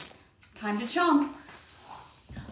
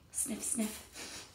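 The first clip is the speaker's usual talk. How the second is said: sniffing; 15 dB quieter than usual. Acted. Wolf sniffing the air.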